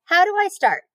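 high eager question. Acted. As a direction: ask like an excited piglet. Eager pig asks.

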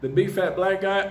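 normal talking voice

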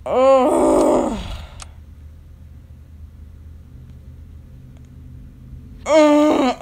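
loud drawn-out groan of defeat; loud frustrated groan. Real, not acted. Gamer groaning loudly to viewers after losing.

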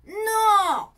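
loud, high, stubborn NO; grumpy boy shouting no; shouted no. Acted. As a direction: fling the refusal out like a sulking boy. Ned refuses snack.

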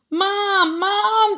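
high-pitched alarmed call; screamed. Acted. Jasper calls Mom about the shed, screaming. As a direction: cry out high for help. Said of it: "Jasper screamed".

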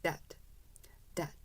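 dad said like dead, short and loose; dad with a small e vowel; relaxed dead sound. Shown as a demonstration. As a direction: say dad with no force at all. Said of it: "힘을 붙이지 마시고".

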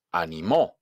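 "animó" punched on the last syllable MÓ; stress on final O. Shown as a demonstration. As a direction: land hard on the Ó ending "animó". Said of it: "la última tiene acento en la O". ("animó" con golpe de voz en la O final)

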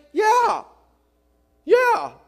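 loud, high shouted yeah; mock eager shout. Preacher mimicking someone caught in sentiment, shouting yes. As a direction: shout it like a swept-up believer.

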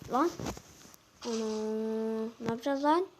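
normal talking voice

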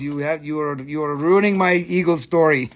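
usual speaking voice

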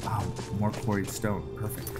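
usual speaking voice

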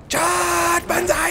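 high, sustained charge cry; hammed-up squealed war cry; banzai battle cry. Gamer launching a banzai charge, high war cry.

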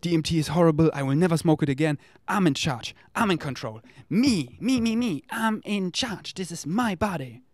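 put-on high, insistent, childish protest; mock bossy ego. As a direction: play a petulant ego claiming control. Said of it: "then you can say".

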